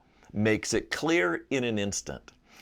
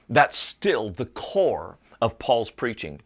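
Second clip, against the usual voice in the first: normal talking voice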